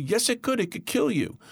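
put-on high, insistent little boy voice; scared child friend. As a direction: voice a frightened five-year-old insisting. Child friend Bobby warns.

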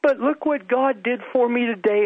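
put-on high-pitched little boy voice, testifying; high, childlike testimony. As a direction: pipe up high like an excited child. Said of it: "there was a little boy".

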